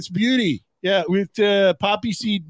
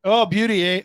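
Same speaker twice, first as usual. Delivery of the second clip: put-on jokey Canadian accent, eh. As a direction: drop a cheery Canadian beauty, eh.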